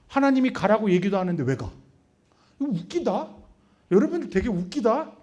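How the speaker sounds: ordinary delivery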